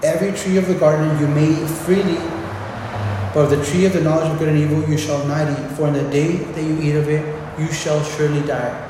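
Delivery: solemn scripture recitation; scripture reading. Acted. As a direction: read it out as the Lord's solemn word. Reading God's command from scripture.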